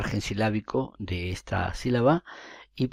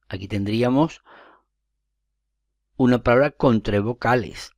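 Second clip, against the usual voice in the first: ordinary talk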